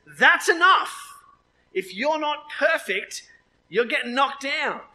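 high, loud, slow declaration; forceful verdict from on high; stern ultimatum. Acted. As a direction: lay down the law as God, word by word. Voices God drawing the line.

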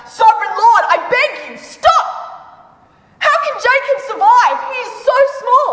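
high, urgent pleading cry. A narrator's reading. Prophet begging the Lord to spare Jacob, high urgent plea. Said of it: "And then I cried out".

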